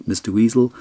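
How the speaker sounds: ordinary delivery, nothing marked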